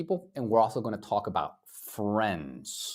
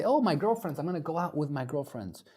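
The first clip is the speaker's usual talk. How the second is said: lightly voiced chatty girl, high on oh; a hint of a gushing young woman; mock girl talking about her friends, gushing; girly voice; 6 semitones higher, faster than usual. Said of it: "some girls, they say".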